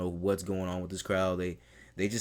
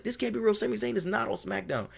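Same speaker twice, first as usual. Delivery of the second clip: Kevin Owens in disbelief; 14 semitones higher than usual. Retold or imitated. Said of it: "Kevin Owens is like".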